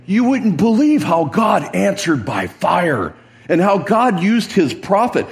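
high, marvelling proclamation; imagined awed report. Acted. King should have told Jezebel of God's fire, marvelling. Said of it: "That's not how he said it".

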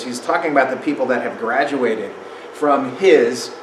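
ordinary talk

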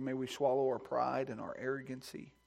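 usual speaking voice

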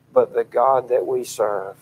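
ordinary talk, nothing marked

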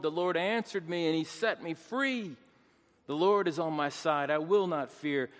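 forceful pulpit psalm reading; declaimed scripture. Real, not acted. Preacher quoting Psalm one eighteen, declaimed scripture. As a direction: proclaim the verses aloud with ringing conviction.

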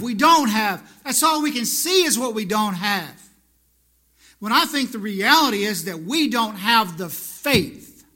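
loud, driving preaching; rising preaching. Real, not acted. Preacher stirring his flock, loud and driving. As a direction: hammer each line home.

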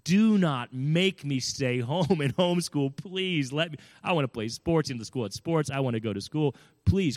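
mock tearful begging to mom; retold crying plea. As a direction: imitate a thirteen-year-old pleading through tears. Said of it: "Bawling, crying".

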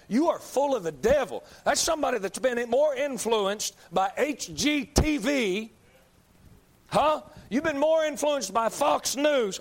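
rising preacher's shout; fiery preaching. Acted. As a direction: build the sermon into a roaring climax. Preaching at full force.

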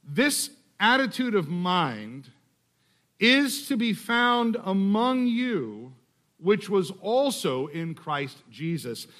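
unhurried scripture reading, lingering on is; slow, deliberate reading. Shown as a demonstration. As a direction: read slowly, each phrase weighed. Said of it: "Here's one way to do it".

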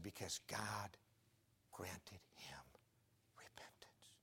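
low whisper, drawn out by pauses; hushed answer. Retold or imitated. Pastor explaining Paul's ten years without cigarettes, in a hushed whisper.

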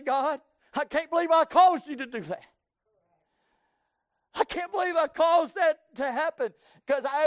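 shouted, high pleading confession; impassioned prayer. Acted. Preacher voicing a repentant sinner's prayer, shouted. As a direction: cry out to God in repentance. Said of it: "look at God and say".

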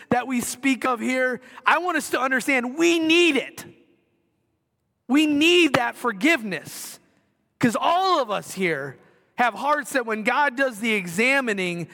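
fervent preacher in full flow; impassioned preaching. Acted. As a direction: drive the point home to the whole gym. Preaching at full force to congregation.

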